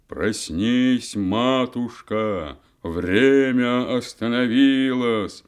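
loud, slow wake-up call; trumpeting. Acted. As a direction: bellow it in her ear. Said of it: "затрубил ей на ухо".

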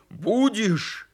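high, sharp demand; angry shout. A narrator's reading. Bear cub threatening the supposed wolf, shouting. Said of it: "кричал он".

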